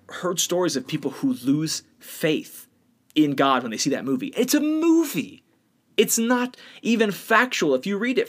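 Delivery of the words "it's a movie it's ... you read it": high, incredulous scoff; exasperated. Real, not acted. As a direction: scoff at how silly it is. Exasperated outburst about movie.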